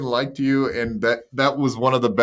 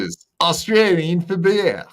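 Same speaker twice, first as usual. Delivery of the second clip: higher mock ad-voice Aussie; a bad Aussie beer-ad line; Australian accent; 6 semitones higher than usual. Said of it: "my horrible impersonations".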